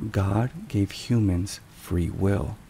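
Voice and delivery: ordinary talk, nothing marked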